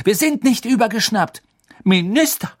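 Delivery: loud, high denial; high-pitched outrage; indignant protest. A narrator's reading. Children protest being called mad.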